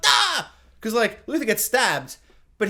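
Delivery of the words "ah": mock scream. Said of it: "all the children be like".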